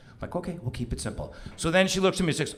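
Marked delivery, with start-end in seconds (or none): 0.3-1.3 retold in a soft undertone; low-key, much softer reply; hushed agreement. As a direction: agree very quietly. Husband agreeing privately in the car.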